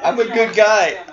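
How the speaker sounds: high, loud mocking quote; mock protest. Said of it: "all of a sudden he's like".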